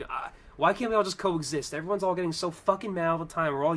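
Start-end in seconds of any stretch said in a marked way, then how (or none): none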